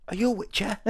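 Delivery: high, put-on voice for a hopeful question; mock high voice; high-pitched stranger. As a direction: pipe it up like a needy stranger. Acted merchant calling to a witcher.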